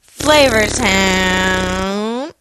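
very slow drawn-out Flavor Town; drawn-out announcer voice. Pretend. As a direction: stretch it out like a show intro. Drawn-out catchphrase.

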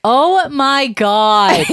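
very slow, loud, drawn-out disbelief; stunned shock. Real, not acted. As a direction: stretch it out, appalled at the photo. Reacting to the grandfather's photo.

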